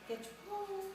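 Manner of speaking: imitated mourning dove; dove's call. A narrator's reading. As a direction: coo it high like a dove, holding "pole". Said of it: "The dove said".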